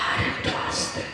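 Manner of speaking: breathy whispered plea for quiet; barely a whisper. Shown as a demonstration. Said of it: "যত আস্তে করে বলতে বলো". (ফিসফিস করে)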